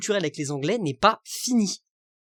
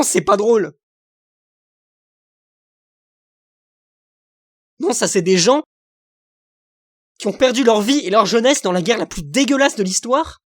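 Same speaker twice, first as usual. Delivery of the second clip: loud, indignant tirade, voice raised; furious outburst; 6 dB louder than usual. Real, not acted. Vlogger to his viewers about mocked war dead, furious shouting. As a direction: let the anger boil over about the war dead.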